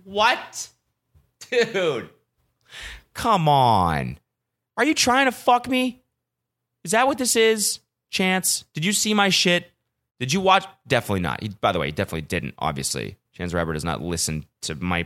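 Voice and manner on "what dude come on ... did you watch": outraged disbelief. Real, not acted. Addressing [name] online, high outraged disbelief. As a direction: protest like you've been betrayed.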